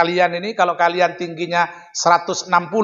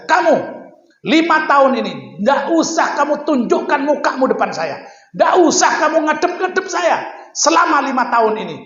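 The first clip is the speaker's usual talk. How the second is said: angry order; 6 semitones higher than usual. Retold or imitated. The kiai sternly banishing the founder for five years. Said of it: "Beliau berdiri sambil marah kepada saya".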